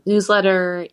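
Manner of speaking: normal talking voice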